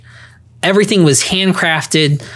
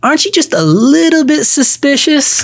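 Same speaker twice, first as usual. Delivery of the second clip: put-on Nelson's smooth Bama accent; 10 semitones higher than usual. Host fondly imitating late mentor Nelson, smooth Bama accent. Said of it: "smooth Bama accent".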